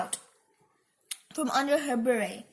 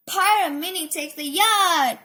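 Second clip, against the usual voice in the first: high, loud pirate announcement; hammed-up triumph; triumphant proclamation; 5 semitones higher, 7 dB louder than usual. A narrator's reading. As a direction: declare it to all aboard. Minnie proclaims her pirate takeover.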